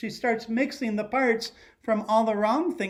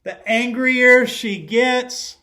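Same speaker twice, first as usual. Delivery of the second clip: angrier stretched and louder; stress on angrier; 4 semitones higher, 8 dB louder, slower than usual. A narrator's reading. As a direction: lean into the word angrier. Building anger in story.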